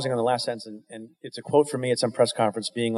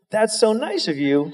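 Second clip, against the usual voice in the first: laughing; 10 semitones higher, slower than usual. Retold or imitated. Retold reply to deputy's joke.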